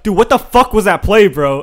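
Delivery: loud, high-pitched mock outrage; toxic teammate. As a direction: imitate a toxic gamer blasting a teammate. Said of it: "I don't say it toxic as fuck".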